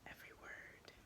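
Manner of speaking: breathy close whisper. Acted. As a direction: lean in and whisper it. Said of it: "Neil leans to whisper".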